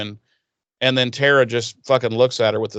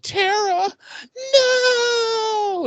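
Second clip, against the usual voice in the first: high desperate cry for Tara; a panicked wail as she runs out; much slower than usual. Retold or imitated. Willow crying out for Tara in panic, high.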